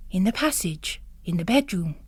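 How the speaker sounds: ordinary delivery, nothing marked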